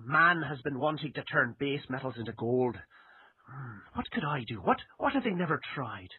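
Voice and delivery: a lightly put-on Irish accent, musing to himself; imitating an Irish inventor's inner voice in a mild Irish accent. Host voicing the alchemist's thoughts in a light Irish accent. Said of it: "forgive my Irish accent".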